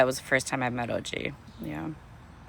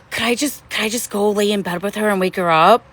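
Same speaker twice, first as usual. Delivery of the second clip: pleading ask; 7 semitones higher, 5 dB louder than usual. Retold or imitated. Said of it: "And I was like".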